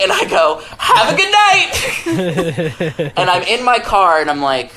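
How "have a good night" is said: high, long drawn-out mock-cheerful shout; defiant call-out. Nineteen-year-old delivery guy to angry customer, defiant drawn-out shout. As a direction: call it back loudly, stretching every word.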